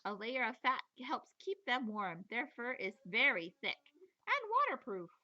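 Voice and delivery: usual speaking voice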